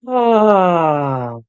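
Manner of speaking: loud open released sigh. Shown as a demonstration. Teacher sighs out a deep breath for viewers. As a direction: let the breath fall out with sound. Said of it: "take some deep breaths and sigh".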